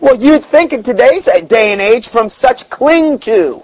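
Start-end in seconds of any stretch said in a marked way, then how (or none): 2.1-3.6 slow and higher, sarcastic twist of the verse; mocking misquote. Preacher mocking the apostate church, slow and sarcastic. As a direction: drag out "cling to" with sarcasm. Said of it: "would be the way that the modern day apostate church".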